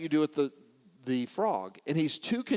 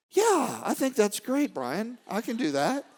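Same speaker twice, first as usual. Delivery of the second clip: high-pitched, lilting mock guru voice; Jay Shetty; 6 semitones higher than usual. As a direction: imitate a Zen master's agreeable reply, pitched up. Acts out Jay's mellow reply.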